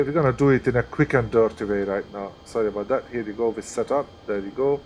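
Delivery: ordinary talk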